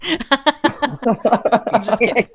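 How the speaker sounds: high, loud giggly laugh through the words. Real, not acted. Host to the guest, laughing, taking back her own joke.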